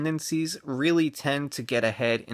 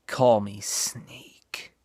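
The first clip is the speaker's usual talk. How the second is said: low, slow whispered tough-guy line; Snake Plissken; 4 semitones lower, much slower than usual. Acted. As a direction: whisper it slow, a bad movie-hero impression. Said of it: "that was a bad impression".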